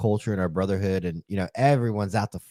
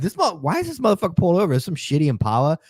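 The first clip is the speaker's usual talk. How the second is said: higher, hurried retold griping; mock outburst at a driver, high and quick; irritated disbelief; 7 semitones higher, faster than usual. Officer recalling a car he pulled over, irritated disbelief. Retelling his irritated roadside reaction.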